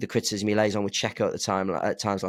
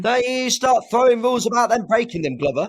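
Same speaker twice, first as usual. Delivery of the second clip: mock scolding; 14 semitones higher, 6 dB louder than usual.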